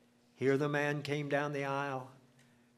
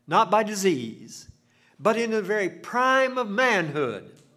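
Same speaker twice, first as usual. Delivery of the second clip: preaching climax; 7 semitones higher, 9 dB louder than usual. Acted.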